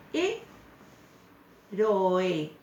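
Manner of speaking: "E, ro, e" in three syllables, with a long pause after the first E; sílabas separadas. Shown as a demonstration. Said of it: "Va separado, son tres sílabas". ("E, ro, e" separado en tres sílabas)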